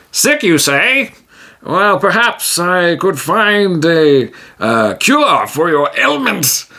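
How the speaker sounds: drawing out a bargain; scheming hero. Acted. Tijim pitching a paid cure to the orc, slyly.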